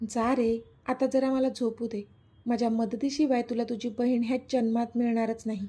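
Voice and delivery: ordinary delivery, nothing marked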